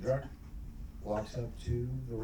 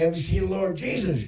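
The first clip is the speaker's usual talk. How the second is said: louder, higher, exasperated preacher; upset preacher; 4 semitones higher, 5 dB louder than usual. Acted. Preacher questioning the dunked drunk, upset and insistent. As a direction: demand it like a preacher losing patience. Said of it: "preacher gets a little upset".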